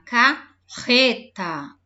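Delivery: carreta slow and loud with a strong rr; teaching word. Shown as a demonstration. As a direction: draw out carreta, stressing the rr. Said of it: "R forte, olha aqui com os dois R's".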